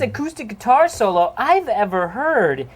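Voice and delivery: loud, high, drawn-out story announcement; announcer. Real, not acted. Host introduces the next story, big announcer delivery. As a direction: announce it big, stretching the words.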